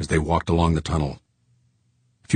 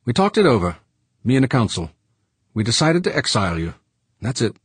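gruff George voice; 6 semitones higher, faster than usual. Acted. George explains decision.

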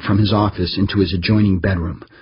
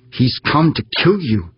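higher, curt warning; sharp snap; 6 semitones higher than usual. A narrator's reading. Farrar snapping at Humphreys, angry and curt. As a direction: bite the words off angrily. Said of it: "she snapped".

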